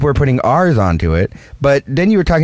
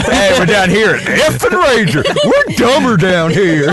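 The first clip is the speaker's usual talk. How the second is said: higher-pitched hammed-up host; mock show intro; 6 semitones higher than usual. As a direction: announce it like a goofy rival show, half laughing. Mock intro of their own show.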